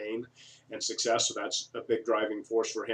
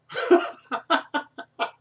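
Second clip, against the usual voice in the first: high, loud burst of laughter; helpless cackling laugh; real laugh; 7 dB louder than usual. Real, not acted. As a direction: crack up at your own story. Laughs at his own story.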